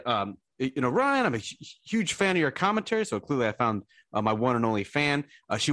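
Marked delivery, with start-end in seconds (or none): 0.9-3.0 much higher, eager fan voice; gushing lady fan. Retold or imitated. Fan meeting Ryan before a TV hit, much higher voice. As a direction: squeal it like a starstruck admirer. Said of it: "but she said".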